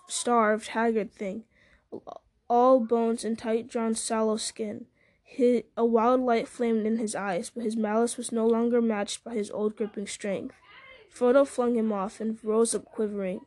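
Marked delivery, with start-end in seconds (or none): none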